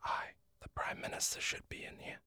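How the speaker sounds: soft whispered aside. Acted. Outside the debating chamber door, whispered. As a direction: murmur it under your breath.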